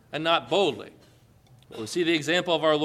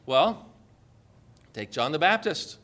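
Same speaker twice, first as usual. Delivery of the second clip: normal talking voice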